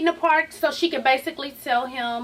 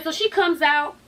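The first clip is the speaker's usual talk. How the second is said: ordinary delivery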